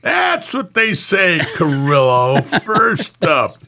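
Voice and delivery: put-on slow, high sarcastic drunk echo; mock his excuse like a drunk; hammed-up drunken sarcasm. Drunk partner mocking excuse.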